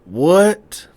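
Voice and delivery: slow build to a high, loud what; man hearing about the cop, high; disbelieving what. Retold or imitated. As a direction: drag out the shock. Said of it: "So he was like".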